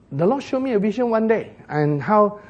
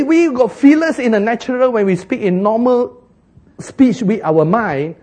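ordinary talk, nothing marked

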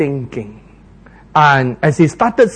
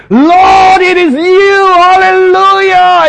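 much higher, loud, triumphant hallelujah; joyful shout; 13 semitones higher, 8 dB louder, slower than usual. Acted. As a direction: shout it with full joy. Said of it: "He did not say".

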